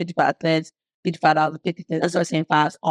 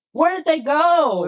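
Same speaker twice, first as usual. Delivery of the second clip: high, slow, drawn-out disbelief; indignant question; 9 semitones higher, slower than usual. Real, not acted. To the host about abandoned women, slow high indignation. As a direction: demand an answer, stretched out slow.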